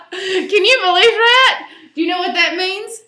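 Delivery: loud high laughter into the question; laughing aloud. Real, not acted. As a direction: laugh at the joke. Laughing at punchline.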